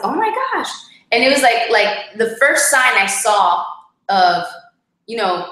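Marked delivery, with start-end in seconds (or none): 0.0-0.7 high-pitched "oh my gosh" in disbelief; shocked gasp. Retold or imitated. Wife retelling her husband walking out, shocked. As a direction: shoot way up in pitch, stunned. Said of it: "And I was like".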